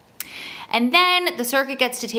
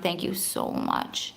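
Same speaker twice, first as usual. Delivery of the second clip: whispered thanks; 3 semitones lower, 6 dB quieter, slower than usual. Real, not acted. Moved thanks to a commenter.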